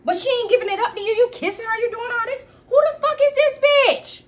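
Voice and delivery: high, fast, rising to an outraged yell; shrill accusing yell. Retold or imitated. Girlfriend yelling at cheating boyfriend, pitch climbing into outrage.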